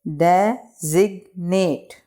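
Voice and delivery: Designate slowly as de, zig, nate; three spaced beats on designate; broken into syllables. Shown as a demonstration. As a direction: pause after each syllable of designate. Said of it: "इसको pronunciate कर लेते हैं".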